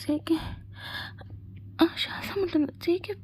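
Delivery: high, soft, breathless pleading whisper. Acted. Girlfriend pleading mid-kiss, breathless and whispering. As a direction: gasp out the words, out of breath and soft.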